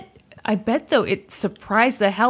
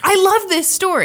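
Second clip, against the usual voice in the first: high, gushing enthusiasm; delighted; 10 semitones higher than usual. Real, not acted. Co-host thrilled by a new story, high delighted delivery. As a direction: gush over the story.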